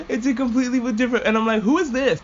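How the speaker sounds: high-pitched, baffled protest; squeaky disbelief; mock bafflement. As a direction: say it high, as if you can't place the voice. Acted bafflement at changed voice.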